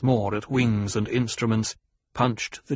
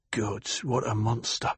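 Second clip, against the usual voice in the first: much softer, under the breath in dread; awed mutter; 6 dB quieter than usual. A narrator's reading. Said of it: "muttered a man beside Paul".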